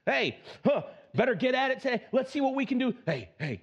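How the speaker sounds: perky self-encouragement to your reflection; pep talk in the mirror. Retold or imitated. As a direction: cheer yourself on like a buddy. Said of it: "this is me in the morning talking to myself in the mirror".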